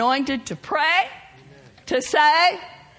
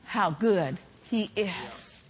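lower, softer preaching, drawing them in; soft intimate preaching; 7 semitones lower, 7 dB quieter than usual. Real, not acted. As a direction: hush it down and plead gently.

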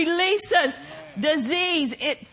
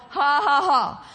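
deliberate stagey ha-ha-ha; scornful laugh in separate syllables; mock divine laughter. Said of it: "is his response".

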